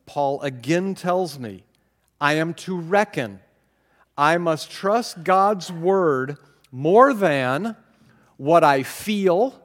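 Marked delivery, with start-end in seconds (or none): 4.1-9.6 slow, louder, deliberate stress on feel; slow emphasis. Real, not acted. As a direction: lean on each word slowly. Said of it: "Oh, that's key".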